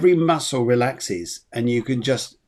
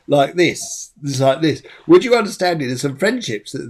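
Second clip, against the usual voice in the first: normal talking voice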